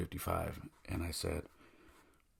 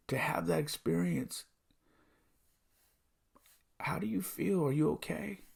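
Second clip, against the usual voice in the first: high, overcome with emotion; choked up; 9 semitones higher than usual. Real, not acted. Father telling how he hugged his son, choked up. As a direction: voice pushed high, as if near tears. Overcome retelling son's happy tears.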